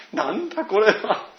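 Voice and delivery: high, laughing reaction of disbelief; retold laughing disbelief. Preacher recalls first hearing the dialect recording, laughing disbelief. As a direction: act out being cracked up and baffled. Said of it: "最初爆笑しました".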